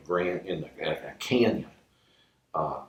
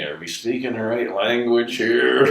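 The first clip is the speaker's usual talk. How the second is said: puppet voice; 4 semitones higher, 6 dB louder than usual. Retold or imitated. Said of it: "the puppet, he says to me".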